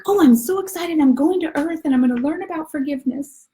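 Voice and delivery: put-on very high, loud childlike glee; excited little soul. Little soul is off to Earth, gleeful and high. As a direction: bubble over in a squeaky little voice.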